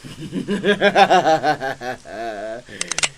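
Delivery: loud cackling villain laugh; maniacal cackle. Acted. Joker laughing at Batman after the fake gun, wild cackle. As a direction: cackle as if you've won.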